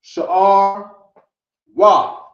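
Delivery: "Sha'ar" then "Wa" called out loud; language demo. Shown as a demonstration. Teacher modelling the phrase for the class, loud. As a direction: sound out "Sha'ar Wa" loudly, apart. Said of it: "I'm gonna say".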